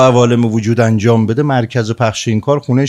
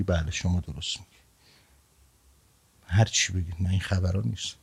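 much softer, sheepish yes-man; a soft, submissive mock reply; mock meek agreement; 4 semitones lower, 13 dB quieter than usual. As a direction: hush down like a blind follower.

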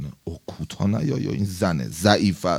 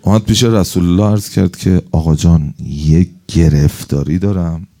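fiery preaching; 4 semitones lower, 11 dB louder than usual. Acted. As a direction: cry it to the congregation. Preaching at full force. (وعظ پرشور)

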